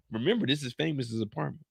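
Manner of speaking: usual speaking voice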